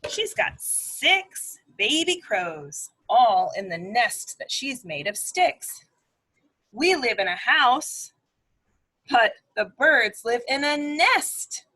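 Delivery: normal talking voice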